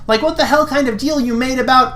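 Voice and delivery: put-on high, pushy girl voice; demanding answers in a high voice; Pidge voice. Girl paladin demanding an explanation, high and pushy.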